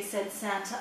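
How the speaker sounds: usual speaking voice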